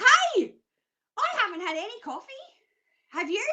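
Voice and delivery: high, loud hello, then perky; mock over-excited greeting. Psychic picking up the phone to a caller, mock excited. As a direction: burst onto the line hammed-up and hyper. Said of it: "I'll be like".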